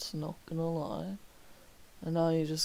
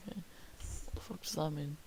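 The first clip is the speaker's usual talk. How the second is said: usual speaking voice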